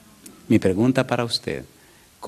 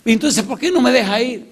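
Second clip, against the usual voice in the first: frustrated shout; 12 semitones higher, 5 dB louder than usual. Retold or imitated. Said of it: "No sé".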